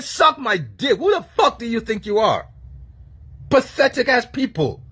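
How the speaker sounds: normal talking voice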